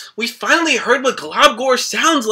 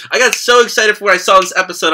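ordinary talk, nothing marked

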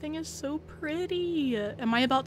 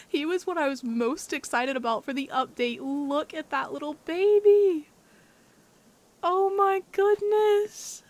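high loud doting gush; adoring squeal; 5 semitones higher, 5 dB louder than usual. Real, not acted. Adoring Nico again.

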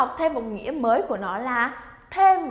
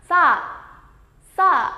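a lively, louder, higher "Sa" to spur someone on; urging with feeling; 4 semitones higher, 4 dB louder than usual. Shown as a demonstration. Teacher shows students an emotional urging, higher and louder. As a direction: push the partner along with "Sa". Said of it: "thả một chút cảm xúc vào nào".

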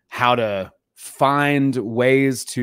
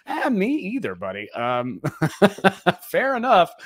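laughing; 10 semitones higher, 4 dB louder than usual. Retold or imitated. Mock reply to author, laughing.

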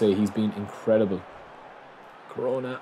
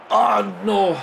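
alarmed; 10 semitones higher than usual. Real, not acted. Opponent attacks.

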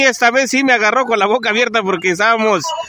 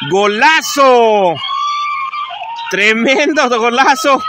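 breakneck goal commentary; 7 semitones higher than usual. Real, not acted. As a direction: roar the goal, dragging out golazo. Goal scored, live commentary.

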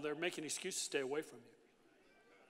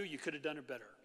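ordinary talk, nothing marked

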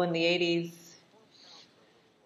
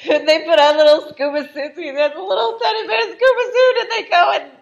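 high, loud, squeaky with laughter; laughing; 10 semitones higher, 9 dB louder than usual. Real, not acted. Viewer retells the teddy bear scuba scene, laughing. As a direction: barely get it out for laughing.

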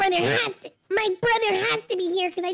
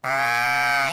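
low disgusted groan. Acted. Brother recoils at poop.

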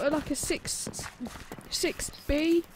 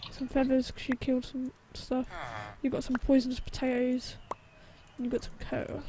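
whispering; 2 semitones lower, 9 dB quieter than usual. Real, not acted. Listing items quietly.

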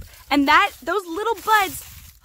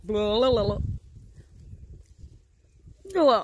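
puckering from sour juice; sour grape taste reaction; 2 semitones lower than usual. Real, not acted. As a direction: mouth full of sour taste. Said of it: "I literally just licked the top of it".

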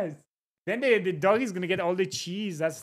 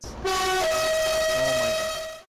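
drawn-out exclaim; 5 semitones lower, much slower than usual. Real, not acted.

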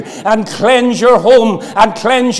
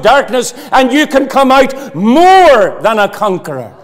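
louder, higher fervent sermon climb; louder, higher preaching at full force; 3 semitones higher, 4 dB louder than usual. Acted. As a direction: preach it out with rising fire.

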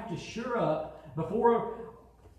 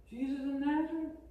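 unsure soldiers; 9 semitones higher, 3 dB quieter than usual. Acted.

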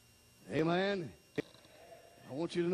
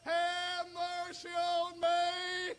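long, high wailing plea; beggar's drawn-out holler; crying out; 12 semitones higher, much slower than usual. Acted. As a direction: cry out for mercy on stretched high notes. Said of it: "he cried out".

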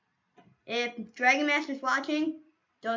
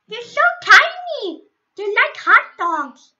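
high, louder squeal over tiny dogs; cute gushing; 9 semitones higher, 9 dB louder than usual. Acted. Streamer gushing to friend about his dogs, high excited squeal. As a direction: coo like they're adorable puppies.

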